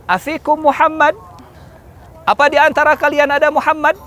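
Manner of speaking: high, rising questioning call. Acted. Abu Sufyan to Muslims on the mountain, high challenging call. Commander calls up to hidden enemy.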